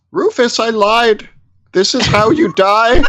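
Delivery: put-on a creepy old man's betrayal. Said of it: "Another frail old man".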